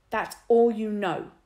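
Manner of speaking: loud, slow indignant retort; loud, deliberate retort. A narrator's reading. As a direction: throw it back hotly. Lloyd red-faced.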